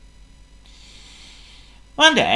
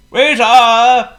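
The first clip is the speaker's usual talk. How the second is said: high loud call for the waiter; 11 semitones higher, 10 dB louder than usual. A narrator's reading. Potto calls for the waiter, loud high call. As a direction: call the waiter across the restaurant. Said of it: "he called".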